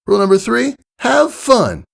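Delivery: Rainbow Dash voice. A narrator's reading. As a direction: instruct the nervous filly like a sporty coach. Flight lesson in character.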